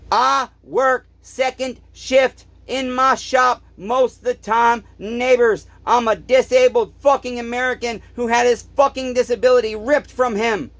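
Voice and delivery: real heated complaint; angry rant. Real, not acted. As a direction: vent at the customer, worked up.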